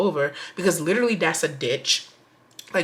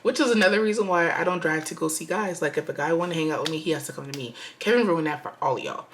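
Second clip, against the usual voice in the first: ordinary delivery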